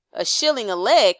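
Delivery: a loud indignant outcry; cried out. A narrator's reading. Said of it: "cried Toad".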